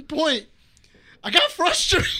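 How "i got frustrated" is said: higher, louder mock exasperation; frustrated. Buddy confessing after Brian leaves, frustrated. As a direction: burst out with it, half laughing. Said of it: "he was so frustrated".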